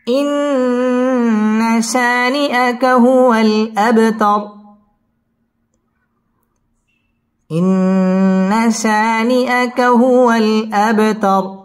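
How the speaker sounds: slow melodic chant, long held vowels; Quran recitation. Shown as a demonstration. Teacher models the verse for students, slow chanted tajweed. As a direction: intone each word, drawn out and high.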